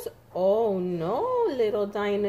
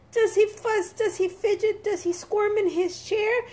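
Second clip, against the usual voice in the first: put-on high, teasing questions to a child; playful high questions; 9 semitones higher than usual. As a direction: tease the little one with each question.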